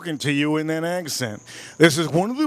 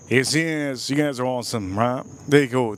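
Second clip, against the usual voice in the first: ordinary talk, nothing marked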